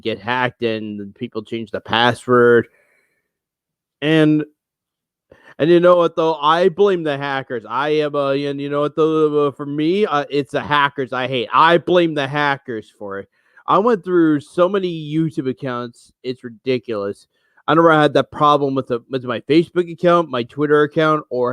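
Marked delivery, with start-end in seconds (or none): none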